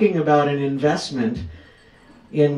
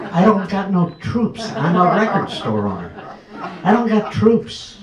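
higher, laughing mock protest; 6 semitones higher, 3 dB louder than usual. Telling the crowd how he protested to Sue, high and laughing. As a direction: play up the disbelief with a laugh.

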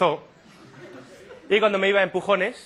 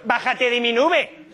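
loud, high, drawn-out retort; hammed-up slow, high exclamation; mock outburst; 7 semitones higher, 4 dB louder, much slower than usual. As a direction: belt it out slowly at the doctor.

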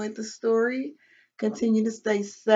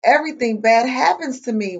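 louder, lightly acted lament; mild storybook wail; 3 semitones higher, 9 dB louder than usual. A narrator's reading. Mouse after sitting on a thistle, mildly wailing. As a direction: wail it lightly, as in a children's book. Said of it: "wailed Mouse".

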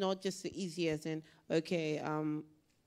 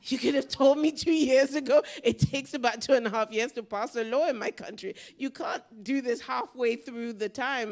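louder and higher, fed-up retold protest; exasperated outburst; 6 semitones higher, 9 dB louder than usual. Retelling how she told the donors off, exasperated and loud. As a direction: let the frustration burst out at them. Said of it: "And that's when I was like".

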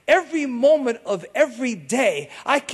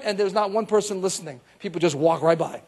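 ordinary delivery

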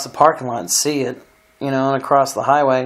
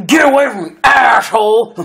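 mock hostile yell, pitched higher and louder; angry yell; 7 semitones higher, 4 dB louder than usual. Stranger shoving a man outside Walmart, retold shout. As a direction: bark it like the angry guy shoving someone. Retelling a hostile guy's outburst.

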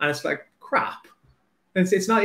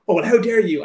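huffy, louder, offended mock retort; mock outrage; 5 semitones higher, 6 dB louder than usual. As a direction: play the offended author, half-joking. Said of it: "getting like".

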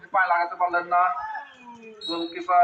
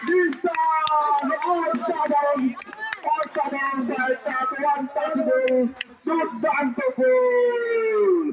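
goal call; 8 semitones higher, slower than usual. Real, not acted. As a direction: scream the goal out for the crowd. Goal scored, commentator erupts. (গোলের চিৎকার)

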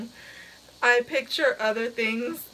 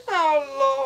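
usual speaking voice

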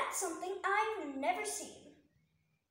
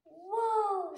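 loud amazed "whoa"; Lucy amazed by the fairy's land, loud; awed whoa; 4 semitones higher, 7 dB louder than usual. Acted. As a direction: gasp in wonder at the land. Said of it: "said Lucy".

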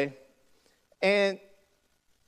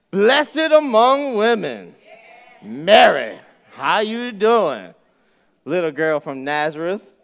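slow, loud, friendly angel greeting; the angel hailing Mary with swagger; playful angel; 6 dB louder, slower than usual. Acted.

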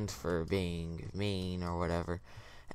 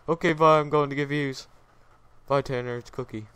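higher, louder imitation of a friend; mock goodbye; 6 semitones higher, 6 dB louder than usual. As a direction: toss off a hurried, mocking farewell. Mimics friend abruptly leaving him.

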